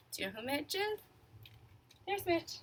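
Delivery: ordinary delivery, nothing marked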